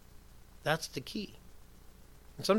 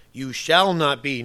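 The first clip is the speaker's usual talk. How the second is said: slow, louder stress on "You shall not be"; "You shall not be" as a firm, drawn-out command; very emphatic; 6 dB louder, slower than usual. Retold or imitated. Preacher quoting Jesus's command, very emphatic. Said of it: "Notice the very emphatic tone".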